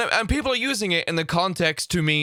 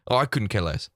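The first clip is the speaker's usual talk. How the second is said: quick, low throwaway COULDN'T CARE LESS; said too quickly; 5 semitones lower than usual. Acted. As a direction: rattle off COULDN'T so it nearly loses its ending. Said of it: "they said it too quickly".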